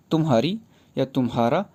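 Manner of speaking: ordinary talk, nothing marked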